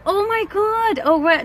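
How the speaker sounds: high, stunned disbelief; shocked oh my God. Retold or imitated. Reacting to a coworker's excuse, high and stunned. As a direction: gasp it out in shock. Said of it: "I'm like over here".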